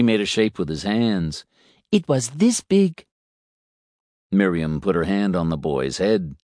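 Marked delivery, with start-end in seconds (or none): 1.9-3.0 put-on high, eager child voice; excited little boy. A narrator's reading. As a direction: tell it like a proud small boy. Boy shows fish size.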